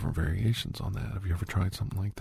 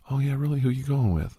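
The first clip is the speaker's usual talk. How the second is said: higher-pitched interested reply; acted dance partner; 7 semitones higher than usual. Coach plays the girl asking about prom, acted curious partner.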